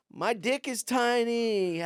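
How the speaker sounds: high, slow, put-on joke voice; mock stage delivery. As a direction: deliver the punchline like a hammy comic. Acting out his own joke on stage.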